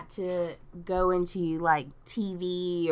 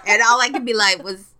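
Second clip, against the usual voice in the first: normal talking voice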